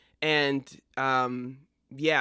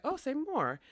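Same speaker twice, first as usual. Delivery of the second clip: mock intrigued; 11 semitones higher, 4 dB quieter than usual. Acted reply of Brutus in play.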